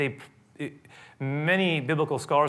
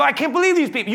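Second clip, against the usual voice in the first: higher, louder incredulous exclamation; fed-up onlooker, raised voice; exasperated disbelief; 13 semitones higher, 7 dB louder, faster than usual. Retold or imitated. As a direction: throw up your hands at them. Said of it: "you go".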